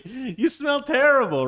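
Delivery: high, loud, drawn-out complaint; disgusted cat. Acted. Female cat to the skunk chasing her, disgusted high wail.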